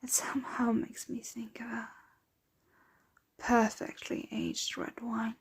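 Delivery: soft, lingering appreciation; hushed, dreamy relish; savouring. Acted. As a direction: linger quietly over each word as over fine wine. Savouring blood like wine.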